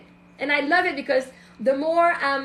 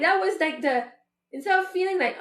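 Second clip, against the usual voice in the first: usual speaking voice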